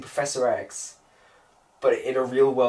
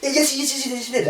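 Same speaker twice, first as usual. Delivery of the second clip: put-on insistent kid; 12 semitones higher than usual. Boy insists to his mum the girl did it, high and nagging. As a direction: insist over and over like a bratty kid. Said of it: "And just".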